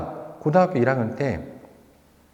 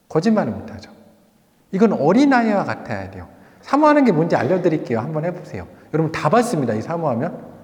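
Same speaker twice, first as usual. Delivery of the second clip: ordinary talk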